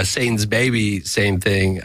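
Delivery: usual speaking voice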